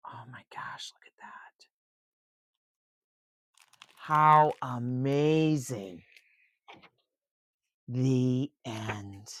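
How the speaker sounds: ordinary delivery, nothing marked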